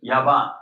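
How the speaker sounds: Yaban for Japan, Y for J and B for P. Shown as a demonstration. Teacher showing Arabs' word for Japan, B in Yaban. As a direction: say Yaban with a firm B. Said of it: "you cannot pronounce P".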